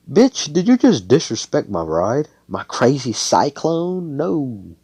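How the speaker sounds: loud, high-pitched offended protest; acted outrage. Magna to Noelle who insulted his ride, outraged. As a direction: play indignant, voice jumping up.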